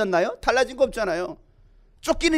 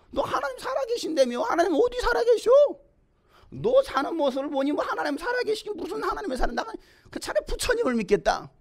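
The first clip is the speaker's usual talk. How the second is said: high-pitched, softer jeering voice; mocking scoffer; 9 semitones higher, 4 dB quieter than usual. Neighbour taunts a believer about a living God, high mocking voice. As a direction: jeer in a raised, mocking pitch.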